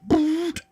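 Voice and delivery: very high-pitched vocal sound effect; sudden high mouth-made boot noise; imitated impact noise. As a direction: make the sound of a shot striking. Game master voices bolt hitting concrete.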